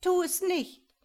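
high, loud, frightened cry; whimpering plea. A narrator's reading. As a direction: beg in sudden panic. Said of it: "wimmerte der Quetzal".

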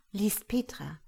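ordinary delivery, nothing marked

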